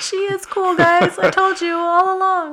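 high, loud playful gloat; mock bragging. Mock boasting after praise.

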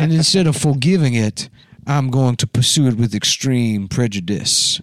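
teasing impression of a genteel Southern dandy; mock Southern dandy voice. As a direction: draw it out like a Southern gentleman. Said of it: "My cool Southern dandy voice".

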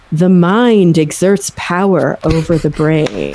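slow, drawn-out solemn statement; promo clip quote. Retold or imitated. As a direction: state it slowly, as if it were absolute. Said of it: "a clip of me going".

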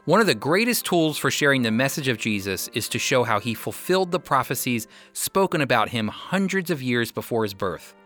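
normal talking voice

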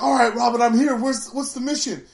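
eager hammed-up sidekick reporting in; Aqualad arrives. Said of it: "He's like".

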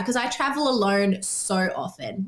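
usual speaking voice

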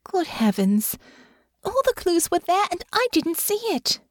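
amazed realization. Acted. Honor realizes she missed clues.

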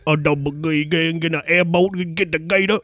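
put-on garbled Creole accent parody; mock Creole chef. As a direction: run the words together like a cartoon Creole cook. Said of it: "could have been a Creole chef".

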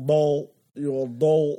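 DOLL exaggerated as a loud, rounded "dole"; mock pronunciation. Teasing co-host over how DOLL is said, mock pronunciation. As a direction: mimic the other's "doll", punching a long O. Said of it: "I'm trying to talk like you".

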